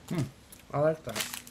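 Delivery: talking while chewing chocolate; muffled by food in mouth; mouth full, chewing. Real, not acted. Seen 2: Man bit chocolate at -2s; mouth still working, hands chocolate over.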